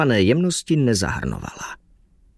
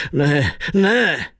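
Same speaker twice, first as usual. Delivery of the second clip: mild narrated cry of protest; 3 semitones higher than usual. A narrator's reading. Driver stopping his passenger, crying out. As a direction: cry out, held in check. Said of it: "vykřikl".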